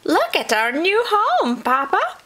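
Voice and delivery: high, loud little-possum voices; excited cry. A narrator's reading. Little possums to Papa on his return, crying out. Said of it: "cried the little possums".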